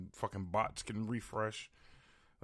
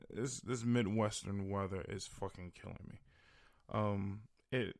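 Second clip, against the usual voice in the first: ordinary talk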